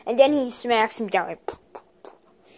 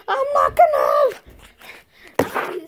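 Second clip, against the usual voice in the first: defiant shout; 13 semitones higher than usual. Pretend. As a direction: yell it out in a high voice like you won't give up. Toy bear yells, refusing to quit.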